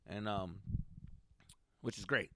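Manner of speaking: ordinary delivery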